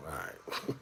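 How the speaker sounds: whispered "All right", resigned. Retold or imitated. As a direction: breathe it out, giving up. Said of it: "I'm like".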